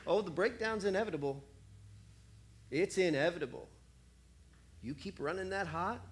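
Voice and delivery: ordinary talk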